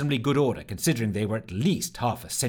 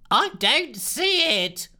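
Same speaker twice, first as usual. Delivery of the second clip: much higher, slow, loud wail; fretful scientist wailing; 14 semitones higher, 4 dB louder, much slower than usual. Acted. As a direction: wail it so nobody can miss it. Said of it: "wailed Lushthorpe".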